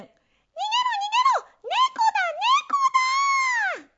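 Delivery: panicked. Acted. Mice panic.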